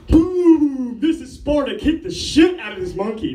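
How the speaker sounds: high, loud mock war cry; hammed-up shout, pitch leaping then easing down; battle cry. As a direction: yell it like a hero landing a kick. Acting out kicking the monkey.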